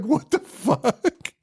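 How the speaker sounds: high, slow laughing disbelief; baffled viewer. Retold or imitated. Viewer reacting to Santa's line, high laughing disbelief. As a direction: laugh it out, stunned. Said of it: "And you're like".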